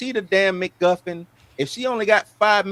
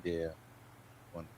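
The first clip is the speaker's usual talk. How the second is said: real lack of enthusiasm; unenthused; 11 semitones lower, 21 dB quieter, faster than usual. Real, not acted. Co-host answering news of Wonder Man, unenthused. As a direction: say it with little interest. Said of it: "don't sound so enthused".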